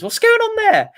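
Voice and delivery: a high, amused what-is-this; baffled squeak at the screen; surprised. Real, not acted. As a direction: pitch it way up in disbelief. Noticing small badges.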